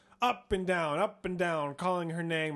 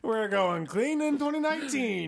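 high, louder mocking imitation; Chad; 7 semitones higher, 4 dB louder than usual. Friend mimics co-host's doubtful vow to stop swearing, mocking. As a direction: quote the vow back in teasing, raised pitch. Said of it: "while he was saying that".

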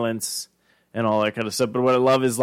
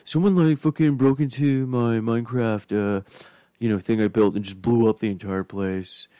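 mock depressed. Coworker moaning about his ruined Minecraft world, mock depressed. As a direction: mimic a sulker, voice dropping as it goes. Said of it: "he was just all depressed".

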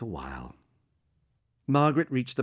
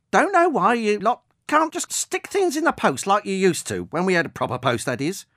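higher and louder, a suspicious, cursing pensioner; grumbling old woman; 9 semitones higher, 6 dB louder than usual. Acted. Old woman distrusting the drone, grumbling loudly. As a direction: grouse about the propellers and the post.

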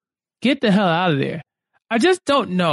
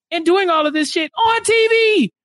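angry outburst; 9 semitones higher than usual. Real, not acted. Angry rant at Sumit.

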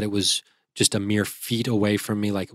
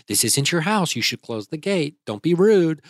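higher-pitched imitation of Mom; mother's scolding voice; 6 semitones higher than usual. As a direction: lightly mimic a mother chiding her son. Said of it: "my mother's voice is in the back of my head".

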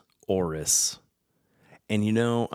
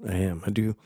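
ordinary talk, nothing marked

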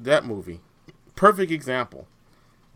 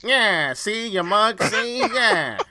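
Brooklyn tough guy; 6 semitones higher, 3 dB louder, much slower than usual. Retold or imitated. Continues Brooklyn bit.

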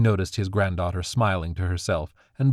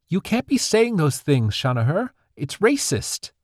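put-on higher, reproachful girl's voice; scolding girl; 9 semitones higher than usual. Granddaughter sides with her mother, scolding her grandfather. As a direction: tell him off, young and indignant.

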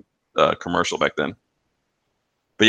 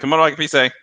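higher, sing-song phone greeting; commercial phone greeting; 6 semitones higher, 3 dB louder than usual. Retold or imitated. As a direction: answer the phone like the ad. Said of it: "people call and be like".

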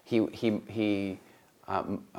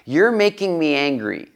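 imitating his son; 8 semitones higher, much slower than usual. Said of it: "one day he goes".

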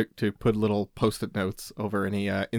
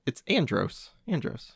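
softly spoken, slightly higher know-it-all correction; arrogant correction; 3 semitones higher, 5 dB quieter, slower than usual. Real, not acted. Host corrects co-host on a Star Fox name, arrogant. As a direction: correct them with quiet superiority. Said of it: "You just got so arrogant".

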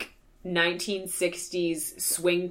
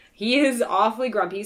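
ordinary talk

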